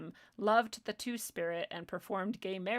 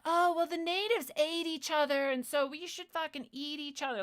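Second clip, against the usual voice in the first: high, put-on New Age believer; mock idiot voice; 5 semitones higher than usual. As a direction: imitate an airhead believer, pitched up. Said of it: "'cause you sound like an idiot".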